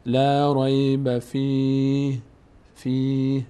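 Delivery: slow recitation, فِیهِ ending on a still h; pausal reading demo. Shown as a demonstration. Teacher showing a stop at فِیهِ, slow pausal reading. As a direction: stretch "fii" in فِیهِ and drop the final vowel.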